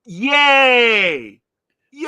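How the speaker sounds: loud, high joyful yay; excited cheer. Real, not acted. Cheering for Ultra the Multi-Alien.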